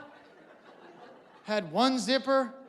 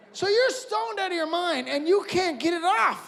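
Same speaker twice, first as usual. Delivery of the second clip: excited rant; 8 semitones higher than usual. Real, not acted. Rant continues.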